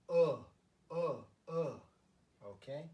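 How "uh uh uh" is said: "uh" repeated as a separate, stressed vowel; Korean EO sound. Shown as a demonstration. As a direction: model "uh" loudly, pausing between repeats. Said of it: "the E, O is".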